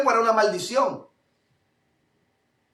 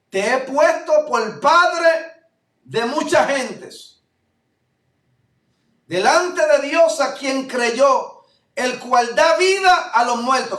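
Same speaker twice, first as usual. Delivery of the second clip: slow and louder, each phrase weighted like holy writ; solemn scripture reading; 4 semitones higher, 5 dB louder, slower than usual. Acted. Preacher reading scripture to the congregation, slow and solemn. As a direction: read the verse out slowly and grandly to the church. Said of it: "Como está escrito".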